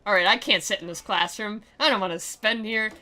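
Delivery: normal talking voice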